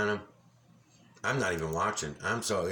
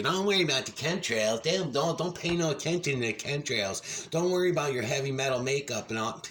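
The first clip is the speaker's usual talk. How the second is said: higher mocking sing-song of a smug dismisser; mocking Brian Austin Lambert; 5 semitones higher than usual. Mocking a critic who dismisses chemtrails, in a higher whiny voice. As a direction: parody a know-it-all brushing off worries. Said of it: "Brian Austin Lambert will tell you".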